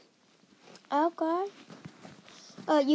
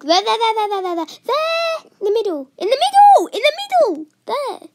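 high, loud urgent shouts; shouting; 8 semitones higher, 10 dB louder than usual. Real, not acted. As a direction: yell it to point the way. Urgent calls to teammate.

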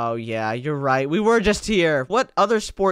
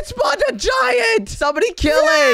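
panicked shout; 5 dB louder than usual. Acted. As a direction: yell for help at a giant. Giant attacks, yelling for help.

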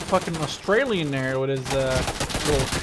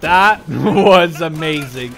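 loud, slow laughing burst of delight; laughing thrill; 12 dB louder, much slower than usual. Real, not acted. Gamer after a frantic firefight, laughing excitedly.

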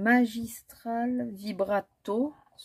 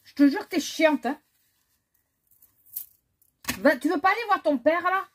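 higher, loud, fed-up telling-off; exasperated scolding; 9 semitones higher, 9 dB louder than usual. Real, not acted. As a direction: scold loudly, pitch jumping up. Exasperated scolding of Lila while filming. (réprimande exaspérée)

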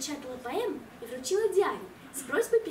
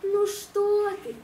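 soothing mother; 6 semitones higher, 5 dB louder, much slower than usual. Acted.

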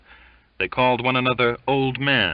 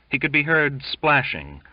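ordinary delivery

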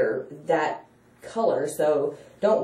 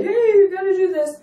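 high, loud excited call; 12 semitones higher, 5 dB louder than usual. Pretend. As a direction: gush it out to everyone. Imagined telling everybody excitedly.

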